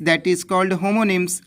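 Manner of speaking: slow, even dictation of the term. Shown as a demonstration. Teacher dictating the key term, slow. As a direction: read it out slowly for notes.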